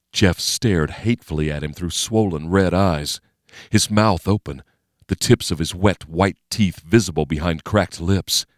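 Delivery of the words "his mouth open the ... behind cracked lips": usual speaking voice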